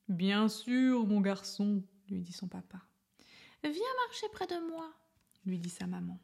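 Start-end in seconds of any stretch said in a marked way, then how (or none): 0.1-1.8 louder, lightly voiced kind father; a father welcoming his son back; papa elephant voice. A narrator's reading. Papa elephant answering his son, lightly voiced kind father. 3.6-4.9 high, gentle mother voice; a mother calling her little one close; maman elephant voice. A narrator's reading. Maman elephant inviting her son, high gentle voice.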